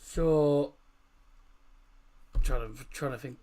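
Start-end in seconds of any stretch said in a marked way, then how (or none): none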